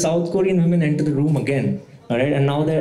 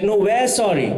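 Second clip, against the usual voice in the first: high pitched polite insistence; mock insistent apology; 8 semitones higher than usual. Comic imitating woman insisting she's sorry. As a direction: outdo him in apologizing.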